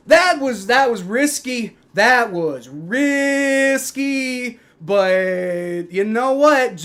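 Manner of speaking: loud, high, drawn-out words; amazed. Real, not acted. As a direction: stretch it out, still buzzing from the gamble. Relieved after gamble pays off.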